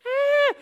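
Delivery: put-on high-pitched girly shriek; little-girl scream. Teacher mimics screaming disciples for kids, high girlish scream. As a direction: squeal like frightened little girls. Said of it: "they're screaming like little girls".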